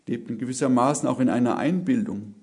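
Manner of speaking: ordinary delivery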